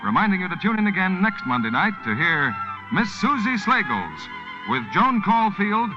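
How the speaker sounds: ordinary talk, nothing marked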